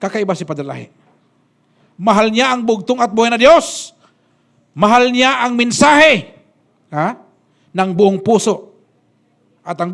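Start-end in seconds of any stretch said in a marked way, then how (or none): 1.9-8.8 loud, slow, high preaching cry; full-force preaching. Acted. Preacher proclaiming to the congregation, loud, slow and high. Preaching loudly about Padre Lahi.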